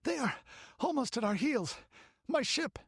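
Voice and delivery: high, breathless panic. Acted. Strom running from the Picts, breathless. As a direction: gasp out the warning. Exhausted effort.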